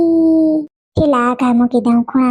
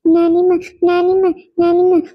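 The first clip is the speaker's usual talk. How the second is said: mock-sobbing child, slightly higher; acted tearful calling; 5 semitones higher than usual. Upset child calling grandmother for comfort, mock tearful. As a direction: call for granny as if crying. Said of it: "કાં મારી દીકરી રોવે છે?".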